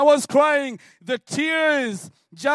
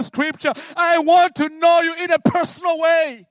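loud, pleading cry to God, much higher; pleading prayer; 6 semitones higher, 5 dB louder than usual. Retold or imitated. A man on his knees loudly begging God. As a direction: beg God with everything you have.

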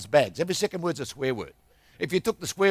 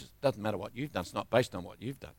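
soft, lower, hushed; quiet comfort; 8 semitones lower, 10 dB quieter than usual. Retold or imitated. Preacher quietly comforts the crying barber lady. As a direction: speak it softly to her.

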